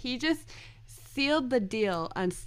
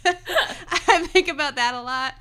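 laughing; 9 semitones higher, 9 dB louder, slower than usual. Real, not acted. Laughing after a funny quote.

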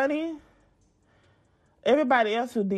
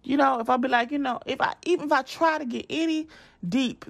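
ordinary talk, nothing marked

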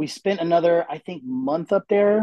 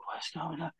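groggy murmur; 2 semitones higher, 17 dB quieter than usual. Retold or imitated. Waking confused in hospital.

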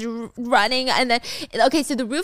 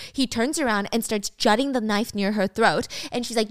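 ordinary delivery, nothing marked

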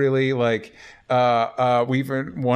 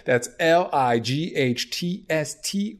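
slow spelled-out URL; 4 semitones higher, much slower than usual. Shown as a demonstration. Cohost spells the sponsor URL slowly for listeners. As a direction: spell each letter slowly. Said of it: "spelling out ad URL".

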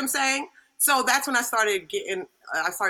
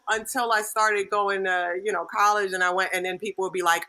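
usual speaking voice

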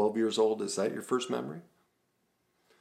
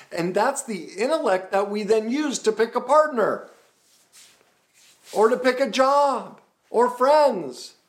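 full-force preaching; 9 dB louder than usual. Acted.

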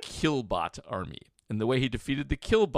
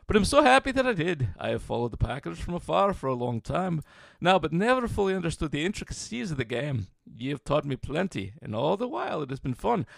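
lilting Irish brogue, hammed up; 3 semitones higher than usual. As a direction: read it in a thick Irish accent. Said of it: "should I do my Irish brogue for the addendum?".

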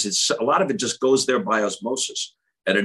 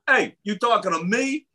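higher-pitched mock Bronx challenge; a hint of Bronx street talk; Bronx accent; 10 semitones higher than usual. Imitating someone who grew up in the Bronx, Bronx accent. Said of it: "You grew up in the Bronx".

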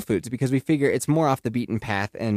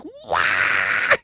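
mock toddler wail; 5 semitones lower than usual. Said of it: "And she's just like".

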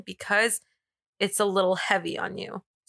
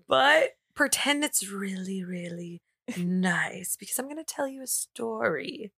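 soft breathy storyteller whisper; sultry whisper; 4 dB quieter than usual. Shown as a demonstration. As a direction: hush it like a secret. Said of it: "sultry, smooth voice here".

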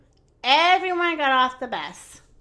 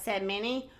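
ordinary delivery

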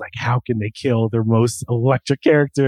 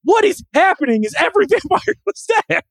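high-pitched, incredulous outburst; amazed realisation; 13 semitones higher than usual. Real, not acted. Host realising every vampire copies one character, amazed. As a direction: climb higher as the realisation hits.

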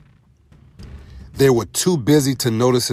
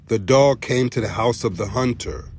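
usual speaking voice

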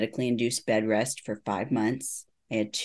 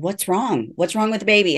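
alarmed mom; 8 semitones higher, 6 dB louder than usual. Retold or imitated. Mother alarmed at her crying.

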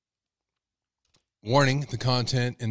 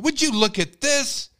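much higher, louder, drawn-out slow outrage; outraged dad; 13 semitones higher, 5 dB louder, slower than usual. Retold or imitated. Father outraged at a loafer price, high and loud. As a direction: plead to nobody in disbelief. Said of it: "dad's price rant again".

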